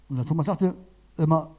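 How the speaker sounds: normal talking voice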